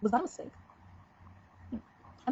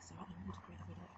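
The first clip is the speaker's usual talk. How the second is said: soft grandmother; 15 dB quieter, faster than usual. Retold or imitated. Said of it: "and she's like".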